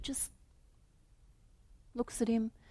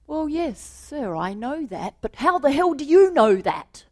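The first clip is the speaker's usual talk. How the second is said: exasperated manager; 6 semitones higher, 7 dB louder than usual. Acted. Manager's punchline retort.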